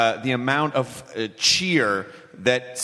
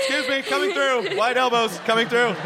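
pushy crowd voice; 8 semitones higher than usual. Pretend. Mimes elbowing through a crowd.

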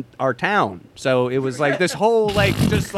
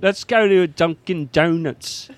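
Cockney bruv, slower; mock British accent; slower than usual. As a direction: lay the London accent on thick. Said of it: "sounds a lot like Michael Caine".

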